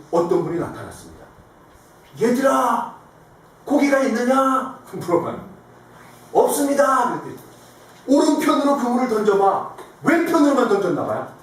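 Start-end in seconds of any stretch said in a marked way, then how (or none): 2.2-4.8 higher, drawn-out call to the fishermen; raised, slow hailing voice. Acted. Jesus calls out to fishermen. 6.3-7.2 higher-pitched shouted reply; calling back. Acted. Disciples call back to Jesus. 8.1-9.7 high-pitched shouted instruction; raised hailing voice; calling out. Acted. Jesus calls instructions to boat.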